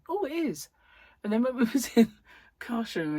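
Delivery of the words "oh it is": high-pitched mock surprised concession; imitated realisation. Partner finally agrees it is smaller, mock surprise. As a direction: leap up on the "oh" as if just noticing. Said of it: "And he went".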